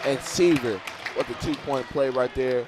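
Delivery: loud high shout calling the assist; fired-up commentator shouting the score; excited play call. Real, not acted. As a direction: belt out the play as it finishes.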